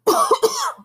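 dry throat cough; real cough mid-read; coughing fit. Real, not acted. Reader coughs.

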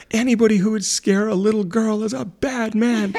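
put-on high toddler voice with a laugh in it; little girl voice. Mom imitating her toddler scolding the prankster, high and laughing. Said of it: "and she said".

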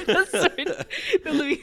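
breaking into high laughter mid-phrase; laughing at her own slip. Real, not acted. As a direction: giggle through the words.

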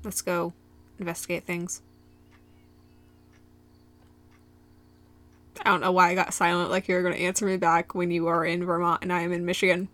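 soft, even robot voice. Real, not acted. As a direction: speak like a robot, flat and steady. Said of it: "I'm talking like a robot".

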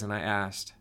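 ordinary delivery, nothing marked

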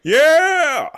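huge high yell into the mic; wrestler battle yell. Acted. Wrestler yelling to pump up the crowd. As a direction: roar to hype the crowd.

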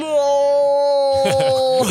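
dramatic long cry. Pretend. Vader's famous No.

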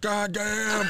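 hammed-up mom in the delivery room; mom's reaction. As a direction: yelp it like a shocked mother. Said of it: "Your mom's like".

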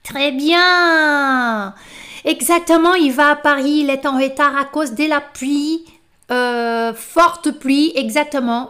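loud, emphatic teacher praise. Real, not acted. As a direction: cheer the class on for the answer. Teacher praising the right answer.

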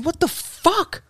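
high drawn-out disbelief; slow, high, baffled disbelief. Retold or imitated. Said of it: "And I'm thinking".

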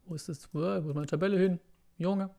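quiet mutter while searching; half under breath, to himself. Real, not acted.

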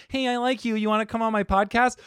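put-on high-pitched pleading voice; mock eager invitation. Host imagining inviting a comedian onto his podcast, mock high voice. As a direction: pitch it up high, parodying yourself asking.

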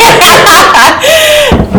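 big high-pitched burst of laughter. Real, not acted. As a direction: laugh out loud, high and bright. Laughs at her own joke.

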